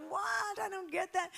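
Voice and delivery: high, loud mock of a confused husband; baffled husband. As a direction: play the husband who can't follow, hammed-up. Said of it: "so he'd be like".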